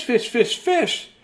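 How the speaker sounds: ordinary delivery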